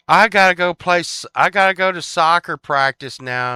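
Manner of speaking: put-on high, loud kid-style whine; mock kid announcement. Friend skipping cartoons for soccer practice, mock kid announcement. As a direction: call it out like a child leaving friends.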